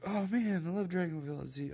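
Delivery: mock-gush about a beloved show; playful imitation of a starry-eyed fan.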